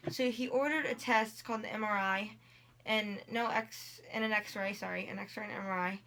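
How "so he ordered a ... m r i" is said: normal talking voice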